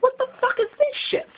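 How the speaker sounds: very high, incredulous outcry; appalled disbelief. Retold or imitated. Watching the new season, appalled high outcry. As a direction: shriek at the new cast. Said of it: "so I'm like".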